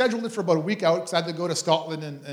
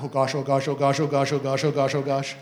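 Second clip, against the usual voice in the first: nervous muttering; 3 semitones lower than usual. Retold or imitated. Nervous after prophesying.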